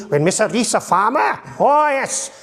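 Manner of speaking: high, broad Welsh farmer. Shown as a demonstration. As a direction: farmer Mr Reese calling the store in a Welsh accent. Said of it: "So we had Stacey".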